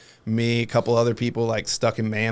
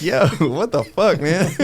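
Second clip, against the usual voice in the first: laughing mock outrage; 7 semitones higher, 6 dB louder than usual.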